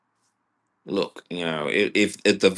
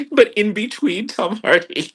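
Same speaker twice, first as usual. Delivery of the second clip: ordinary delivery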